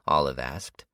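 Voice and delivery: ordinary delivery, nothing marked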